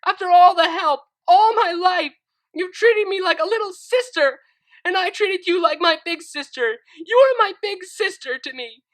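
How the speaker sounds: high, loud tearful shouting; crying and shouting. Acted. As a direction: shout through tears at her betrayer. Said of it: "crying and shouting".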